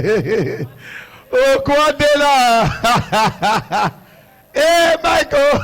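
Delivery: laughing through the words, drawn out; can't stop laughing at the son. Real, not acted. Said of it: "I started laughing".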